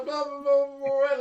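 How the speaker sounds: loud, high nonsense babble of lyrics; incoherent song mumbling. Acted. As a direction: babble along to a song, hyped up. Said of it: "keep mumbling the fucking words to Blur".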